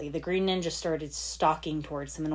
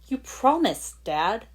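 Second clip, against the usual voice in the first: higher and louder, a hurt accusation; repeating accusingly; 5 semitones higher, 5 dB louder, slower than usual. Acted. Lloyd reminding his father of his promise, accusing. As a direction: press the promise on him. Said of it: "he repeated again".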